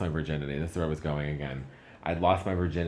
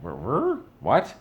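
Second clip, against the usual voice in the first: shocked stammer; 7 semitones higher, 7 dB louder, slower than usual. Retold or imitated. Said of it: "I'm like".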